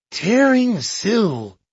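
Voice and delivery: slow and lifted, a touch of discovery; excited. A narrator's reading. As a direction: savour each word, mildly thrilled. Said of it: "explained Poirot".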